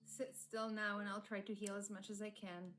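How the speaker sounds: lightly voiced soft-spoken healer; a hint of a calm, caring man; gentle healer voice.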